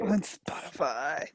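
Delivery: breathy, low whisper repeating the words; whispered echo. Real, not acted. Friend whispering the app name back to her. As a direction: hush it back like a private joke.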